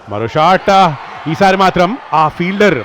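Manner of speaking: usual speaking voice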